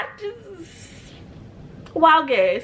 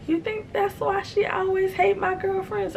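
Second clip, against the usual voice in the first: put-on high, quiet stunned boyfriend; stunned high question; 11 semitones higher, 5 dB quieter than usual. Boyfriend realizes about sister, high stunned question. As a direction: ask it dazed, up high. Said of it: "And he goes".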